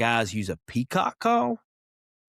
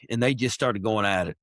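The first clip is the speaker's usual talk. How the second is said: usual speaking voice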